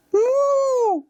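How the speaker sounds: long, very high-pitched moo; squeaky, drawn-out child's moo; cow moo. Acted. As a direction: moo like a little kid playing cow. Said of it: "Cow goes moo".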